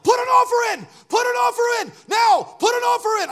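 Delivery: shouted orders at full volume, high; yelling put an offer in; retold yell. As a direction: bark the order like a furious mentor. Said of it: "He yelled".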